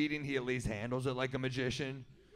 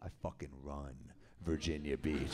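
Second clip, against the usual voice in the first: much lower and softer, a local tough guy; Stu's voice; 12 semitones lower, 7 dB quieter than usual. Retold or imitated. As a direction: drop way down into Stu's low voice. Stu voiced, boasting.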